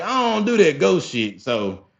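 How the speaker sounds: high, put-on voice of a spooked cousin; high mock-nervous cousin voice; scared cousin. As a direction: play the cousin backing off the ghost. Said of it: "Rodney was like".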